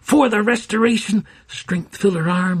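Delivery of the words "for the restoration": raised, loud rallying shout; battle cry. A narrator's reading. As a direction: hurl it like a war cry. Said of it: "she screamed".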